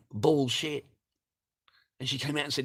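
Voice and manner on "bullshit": mild mock yell; half-raised retold shout. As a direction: repeat the yelled retort, toned down. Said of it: "That's what I yelled out".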